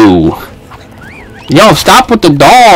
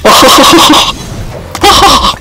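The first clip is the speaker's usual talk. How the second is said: high breathless laugh; 14 semitones higher than usual. Real, not acted. Laughing at opponent.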